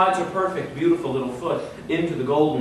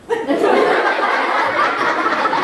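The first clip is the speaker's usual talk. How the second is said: high strained laugh through gritted teeth; high wincing giggle; pained grin laugh; 4 dB louder than usual. Shown as a demonstration. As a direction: squeal a pained smile. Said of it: "here's my impression of her".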